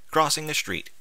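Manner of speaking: ordinary delivery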